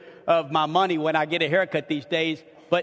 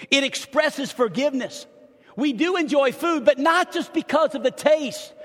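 rising, louder preacher's build; fervent sermon voice, climbing higher and louder; preaching; 8 semitones higher, 4 dB louder than usual. Acted. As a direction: drive the point home to the congregation. Preacher builds on Jesus' dinners.

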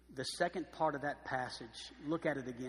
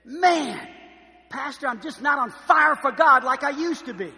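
louder, higher, plaintive complaint; 11 semitones higher, 17 dB louder than usual. Retold or imitated. Churchgoer confessing to the pastor, loud and higher. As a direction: whine it out to the pastor. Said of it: "How many Christians have to confess".

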